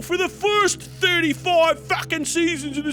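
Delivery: high, loud, wailing panic about British culture; hammed-up high wail of despair; mock British bigot wail. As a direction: play a hysterical pearl-clutching Brit.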